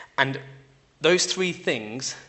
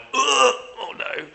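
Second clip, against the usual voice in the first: high, drawn-out groan of dismay; exaggerated "oh no" dread; dismayed first reaction; 10 semitones higher, 6 dB louder, much slower than usual. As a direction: groan it out, mock-alarmed. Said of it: "when I first read it, I went".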